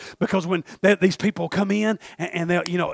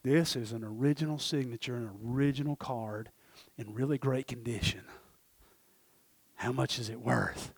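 low, soft, measured examination; studious appraiser; 8 semitones lower, 12 dB quieter than usual. Acted. Experts examine card under magnifying glass, hushed studious tone. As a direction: say it quietly, as if inspecting closely.

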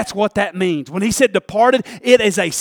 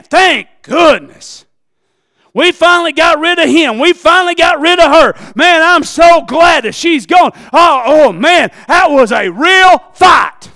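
shouted, rising preacher climax; full-throated sermon climax; preaching at full force; 5 semitones higher, 7 dB louder than usual. Acted. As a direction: preach it loud and hard. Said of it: "preaching at full force".

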